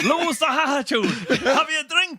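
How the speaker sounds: high, loud laughing cop voice; mock cop lines. Cops quoting the show's lines to him, high and loud through laughter. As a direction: ham up a guard quoting the show. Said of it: "they start doing the lines that you wrote".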